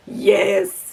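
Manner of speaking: loud, cheering yes; real delighted outburst; triumphant yes. Real, not acted. Cheering the heroine's speech.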